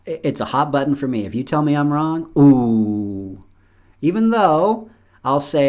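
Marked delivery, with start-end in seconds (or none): 2.4-3.4 ooh. Real, not acted. Reacting to being told wrong.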